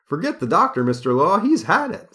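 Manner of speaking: high, loud clown squawk; clown voice again. Acted. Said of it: "says Toby".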